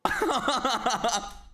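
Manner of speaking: high-pitched cackle; burst of laughter. Real, not acted. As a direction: lose it laughing. Laughing at the replayed word.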